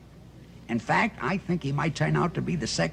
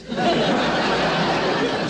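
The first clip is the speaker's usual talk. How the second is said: sheepish laugh at own slip; 9 semitones higher, 5 dB louder than usual. Real, not acted. Embarrassed laugh after a slip.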